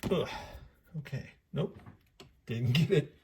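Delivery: out of breath. Real, not acted. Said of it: "Sorry about the breathing hard".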